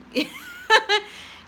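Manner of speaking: high burst of laughter; short high giggle. Real, not acted. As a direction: laugh at your own punchline. Laughing at own joke.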